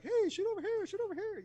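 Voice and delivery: sing-song "shoot over here" as if the antenna were begging to be shot; taunting antenna voice. Retold or imitated. Soldier voicing his antenna calling out to the enemy, squeaky mock taunt.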